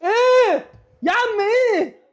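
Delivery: high, loud yell of disbelief; outraged shout. Real, not acted. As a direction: blast the referee at full voice. Outraged at referee's decision. (صرخة غضب عالية في وجه الحكم)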